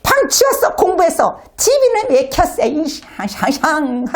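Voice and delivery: hammed-up furious mom yelling at the messy house; high mock tirade, last words slowed and muttered; nagging mom rant. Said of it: "막 불평하고 화를 내고".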